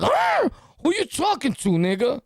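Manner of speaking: high-pitched challenging "huh", then confronting; acted confrontation. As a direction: come at him, mock-aggressive. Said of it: "I come at him. Like".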